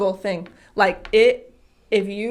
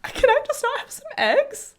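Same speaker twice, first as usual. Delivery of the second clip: put-on very high whiny kid voice; whiny child. Mom mimicking daughter asking for eggs. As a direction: whine like a tired little girl.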